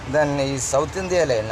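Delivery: normal talking voice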